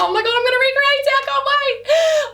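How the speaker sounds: high-pitched excited squeal; buzzing with excitement. Retold or imitated. Vlogger replaying her reaction to fans' praise, excited squeal. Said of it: "I was so buzzing".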